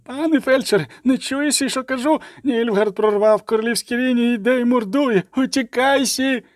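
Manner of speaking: frantic soldier pleading at high pitch; panicked, high-pitched warning cry; high trembling voice. A narrator's reading. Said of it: "крикнув солдат знову високим тремтячим голосом". (високий тремтячий голос, паніка)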